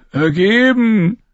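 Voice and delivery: high, very slow drawn-out plea; whining Lommi. A narrator's reading. Lommi suggests giving up, whining. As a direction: draw the word out weakly.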